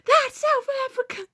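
high, exaggerated teacher voice; mock teacher correction. Mimicking the teacher scolding a wrong answer, high and theatrical. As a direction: pitch way up to play the indignant teacher. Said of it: "Kid says something wrong".

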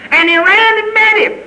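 very high, ringing preaching; fervent, high-pitched proclamation; exultant cry. Real, not acted. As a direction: cry it out at the peak of the story. Climax of the father's welcome.

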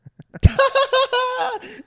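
very high, loud burst of mock laughter; retold helpless laughing. Telling what he did at the herpes confession, retold helpless laughing. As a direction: imitate yourself dying laughing, high and loud. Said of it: "started dying".

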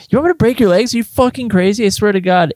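outraged, shouting up at him; angry disbelief. Acted. Shocked by his leg request, angry disbelief. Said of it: "raising my voice with anger".

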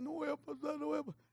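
very soft, discouraged acted voice; hushed mock pessimism; self-doubting murmur. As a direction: mimic a worrier talking under their breath. Voices a doubtful loan applicant.

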